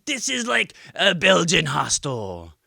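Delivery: put-on lightly done French accent; mock French accent. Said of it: "I can do one accent".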